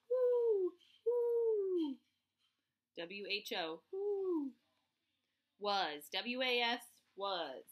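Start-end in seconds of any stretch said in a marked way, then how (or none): 0.1-2.0 high hooting owl voice. Pretend. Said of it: "owl-like hoot for the word who". 3.9-4.5 high hooting owl voice. Pretend. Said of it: "owl hoot again".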